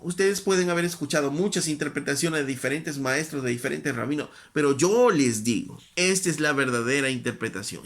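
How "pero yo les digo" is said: yo hit louder with a falling tune; stress on yo. Acted. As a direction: land firmly on yo. Repeats the declaration.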